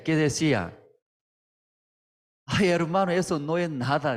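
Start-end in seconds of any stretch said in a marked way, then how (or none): 2.5-4.2 lightly voiced pastor, airy and dismissive; a hint of the pastor waving away a problem; pastor brushing it off.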